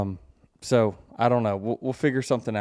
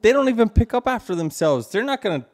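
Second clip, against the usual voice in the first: raised exasperated parent voice; exasperated protest; 11 semitones higher, 6 dB louder than usual. Real, not acted. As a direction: push back hard, voice up and loud. Parent arguing against keeping dog.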